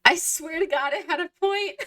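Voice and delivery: high-pitched laughing plea, voice cracking up. Real, not acted. Streamer laughing at her own lost point. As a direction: laugh through it, giving up on the story. Exasperated at losing her story.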